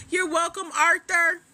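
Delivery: "Arthur" called high and loud, standing in for author; playful "Arthur". Real, not acted. Host greeting a fellow author, "Arthur" in a high, loud playful call. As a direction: punch "Arthur" up high with a relished R. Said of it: "I like saying it like that".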